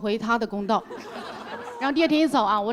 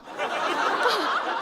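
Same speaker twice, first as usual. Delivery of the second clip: laughing; 10 semitones higher than usual. Real, not acted. 讲述中自己笑出来.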